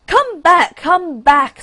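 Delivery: desperate rocket shouting come back; scream. Acted. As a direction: scream after the departing duck. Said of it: "screamed the rocket".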